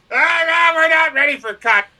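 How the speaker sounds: put-on drunk protest. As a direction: protest like a drunk refusing to stop. Drunk on live show.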